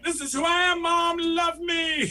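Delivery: high, loud plea from a dog; hammed-up impassioned declaration; mock defiant shout. Dog's coming-out declaration, shouted.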